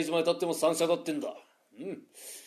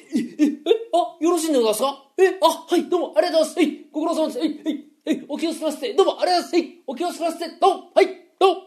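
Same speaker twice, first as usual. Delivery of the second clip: higher, laughing, fawning thank-yous; 8 semitones higher, faster than usual. Acted. Gamblers seeing the boss off with laughing thanks. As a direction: gush thanks while seeing him out.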